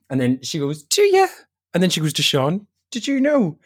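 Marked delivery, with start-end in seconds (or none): 0.8-1.3 very high squeal of a question; imitating a stunned mother; shocked mum. Mum reacts to coming out. 2.9-3.6 high accusing question; imitating mum rounding on her son; incredulous mum. Mum turns to brother.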